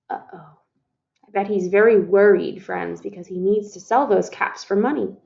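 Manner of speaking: ordinary delivery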